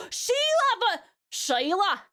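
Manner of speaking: drawn-out, hammed-up Aussie accent; playful botched Australian twang; bad Australian accent. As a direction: attempt an Australian accent and overdo it. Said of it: "that is not how your Australian accent sounds".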